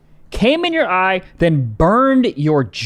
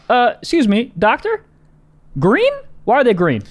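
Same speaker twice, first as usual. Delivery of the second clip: high-pitched, mock-polite query; hesitant, rising questions; puzzled questioning; 6 semitones higher than usual. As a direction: interrupt like a baffled audience member. Acted puzzled questioner interrupting the doctor.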